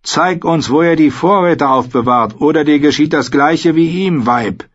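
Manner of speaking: menacing demand. A narrator's reading. Dolch holds a knife to Craster's wife, threatening.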